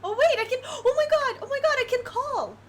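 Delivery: thrilled rush of discovery; excited realization. Real, not acted. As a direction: blurt out the idea you just had. Sudden idea while playing.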